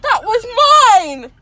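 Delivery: loud, indignant cry; loud outburst. Real, not acted. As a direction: protest something was taken. Sudden outburst.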